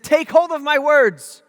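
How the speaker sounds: loud, high, slow command; emphatic quoted grandfatherly command; grandpa's charge. Retold or imitated. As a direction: proclaim it slowly as a solemn charge. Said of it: "starts to quote his grandpa".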